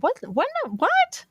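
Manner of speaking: incredulous, leaping into a high squeak; baffled at your own thought, pitch shooting up; mock disbelief. Acts out shock at own thought.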